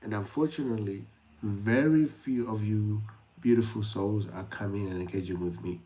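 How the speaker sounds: normal talking voice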